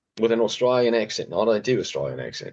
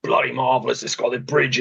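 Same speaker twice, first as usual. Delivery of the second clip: hammed-up Australian actor, pitched up; imitated Australian accent; 3 semitones higher than usual. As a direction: play a chatty Aussie rival. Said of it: "Australia or Australian accents".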